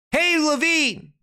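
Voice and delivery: high, slow sarcastic Hey, Levine!; mock how little Trump cares; mocking echo. Preacher mocking Trump's reply to his roommate, sarcastic drawn-out call. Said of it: "he sounds like he really cares".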